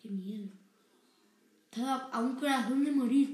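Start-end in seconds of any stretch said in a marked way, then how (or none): 1.7-3.3 excited. Real, not acted. Nearly died in the game.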